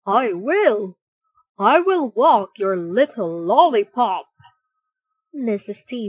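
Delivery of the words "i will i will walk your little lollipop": kind offer. Acted. Soft-hearted Mister Pooter volunteers.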